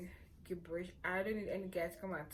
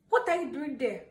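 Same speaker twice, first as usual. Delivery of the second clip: calling out; 6 semitones higher, 12 dB louder than usual. Real, not acted. Sudden call to someone.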